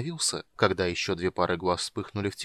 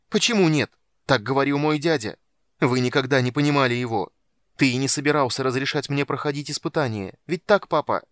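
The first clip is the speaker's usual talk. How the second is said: loud, higher, bitter yelling; angry shout; 4 semitones higher, 7 dB louder than usual. Acted. Son shouts bitterly at his father. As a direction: throw it at the father.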